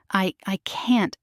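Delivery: plain reading voice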